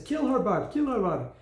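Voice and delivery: coach's urging, retold. Coach to Bart at the hockey penalty shot, retold urging. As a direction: imitate a coach egging on his player. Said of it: "and he says".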